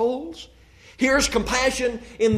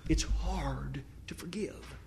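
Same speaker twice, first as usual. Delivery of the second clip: slow hushed whisper, much softer; whispered refrain; 5 semitones lower, 13 dB quieter, slower than usual. Acted. Preacher repeating the refrain to his church, in a slow whisper. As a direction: breathe it out as a private confession.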